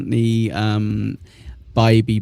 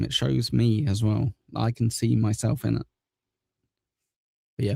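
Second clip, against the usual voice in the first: ordinary delivery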